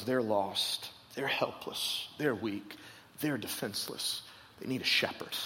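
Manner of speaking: soft, low, half-whispered compassion; tender hush. Retold or imitated. Jesus looks at the crowd, quietly tender. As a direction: breathe it out with pity. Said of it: "he goes".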